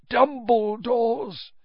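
a dazed madman. A narrator's reading. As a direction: drag the name out dazedly. Dazed Crouch.

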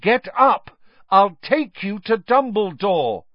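loud clear command; loudly and clearly. A narrator's reading. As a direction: speak loud and clear to a confused man. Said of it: "loudly and clearly".

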